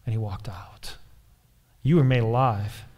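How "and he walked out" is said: whispered, fading to almost nothing; hushed closing line. Real, not acted. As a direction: drop into a whisper for the ending. Hushed end of Lazarus story.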